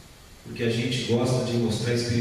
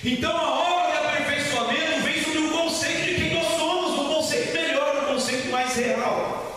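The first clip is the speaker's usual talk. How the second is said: fervent high-pitched preaching, raised voice; preaching at full force; 13 semitones higher than usual. Acted. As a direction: preach it with fire to the congregation. Sung preaching over music.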